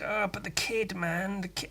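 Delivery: timid worried murmur to a swearing friend; soft pleading. Retold or imitated. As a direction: hush it out, worried for the kid. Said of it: "I was just like".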